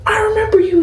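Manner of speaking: high thrilled gasping voice; excited recognition. Retold or imitated. Nurse greeting a returning mom, high delighted voice. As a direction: gush it, delighted to remember her.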